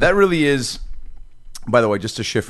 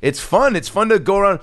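excited; 9 semitones higher than usual. Real, not acted. Excited about parade.

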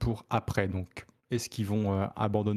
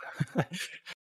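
rire soufflé; 8 semitones higher, 5 dB quieter than usual. Real, not acted. Rit de sa blague.

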